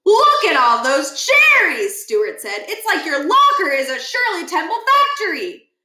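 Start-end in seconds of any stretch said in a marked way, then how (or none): 0.0-2.0 slow high louder wonder; drawn-out awed look; slow amazed Stuart. Acted. Stuart amazed at the cherries, slow and high. 2.7-5.7 put-on a light high dopey boy; lightly voiced dopey kid; goofy Stuart. Stuart to Miles about the locker, high and goofy.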